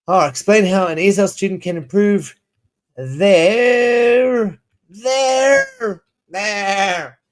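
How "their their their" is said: sheep bleat. Pretend. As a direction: bleat the word their like a sheep, high and wavering. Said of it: "Why did I become a sheep?".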